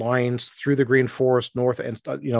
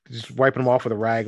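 ordinary talk, nothing marked

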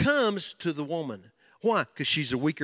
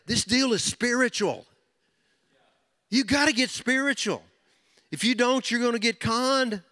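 fervent preaching; 7 semitones higher than usual. Acted. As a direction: drive the point home to the men. Preaching at full force.

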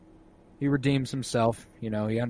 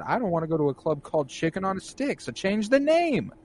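mock girlfriend retort; 8 semitones higher than usual. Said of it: "And she said".

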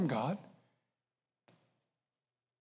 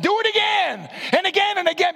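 high, loud preaching shout; 12 semitones higher, 4 dB louder than usual. Real, not acted. Preacher exhorting young people to keep going, shouting. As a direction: drive it home with a shout.

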